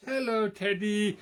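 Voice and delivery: greeting the visiting dog in a high silly voice. Real, not acted. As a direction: coo at the little doggy in a squeaky voice. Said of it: "this silly voice".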